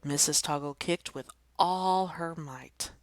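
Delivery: ordinary delivery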